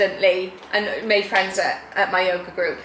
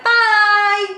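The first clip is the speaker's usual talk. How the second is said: bright goodbye; 11 semitones higher, 6 dB louder than usual. Retold or imitated. Cheerful goodbye to new friend.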